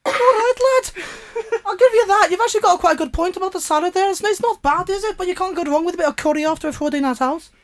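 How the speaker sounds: put-on Brummie accent. Brummie lad chats about curry, high Brummie accent. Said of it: "Stop, Brummy".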